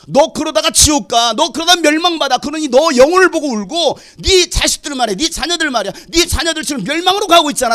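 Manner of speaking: loud, high impassioned preaching; preacher pleading at full force, loud and high; fiery preaching. Acted. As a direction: thunder it at the congregation.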